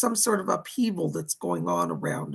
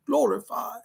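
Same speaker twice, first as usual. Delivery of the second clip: put-on glorified in a light British accent, rising at the end; 2 semitones higher than usual. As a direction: say "Glorified." like a British gentleman, a touch amused. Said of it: "a British man going".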